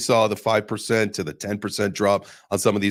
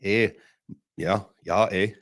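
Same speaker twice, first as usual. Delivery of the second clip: an American's clumsy Canadian "eh"; half-hearted Canadian accent; bad Canadian accent. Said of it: "the most American attempt at the Canadian accent".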